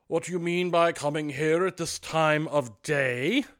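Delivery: loud, slow mock-stern scolding; feigned gruffness. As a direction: put on the old harsh voice as a joke. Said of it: "in his accustomed voice, as near as he could feign it".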